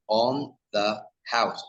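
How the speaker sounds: slow "on the house", every sound given its own space; careful, unlinked "on the house"; clear separate words. Shown as a demonstration. Teacher contrasting careful speech with native speech, slowly. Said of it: "the sounds clearly".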